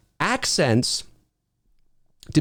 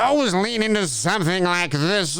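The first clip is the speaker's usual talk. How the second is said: put-on slurred, higher-pitched British drunk; boozy British slur; drunk British caricature; 5 semitones higher than usual. Shown as a demonstration. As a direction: lean into a sloshed, hammed-up British accent. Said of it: "drunk, like I'm completely inebriated".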